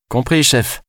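compris chef lancé plus aigu, en se moquant; moqueur. Acted. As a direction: singer le chef avec ironie. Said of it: "se moqua-t-elle". (ton moqueur, plus aigu)

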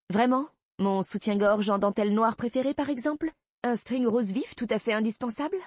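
high, coy questions with rising ends; playful, provocative mock questioning; teasing provocation. Teasing him about her underwear.

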